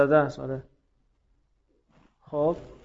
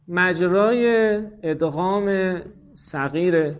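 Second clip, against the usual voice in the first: slow dictation; much slower than usual. Real, not acted. Teacher dictates a grammar rule.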